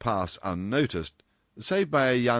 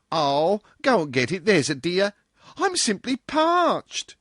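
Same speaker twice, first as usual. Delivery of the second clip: put-on high, pleading young girl, louder, drawing out the last words; girlish cry; 12 semitones higher, 6 dB louder than usual. Marjorie begging Dorothy to fetch tea, high girlish plea. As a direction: beg a friend for tea like a parched schoolgirl. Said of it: "cried Marjorie Rogers".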